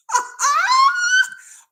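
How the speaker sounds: loud, very high shrieking laugh; mocking shriek. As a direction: let out a piercing, drawn-out mock cackle. Mocks him for lasting this long.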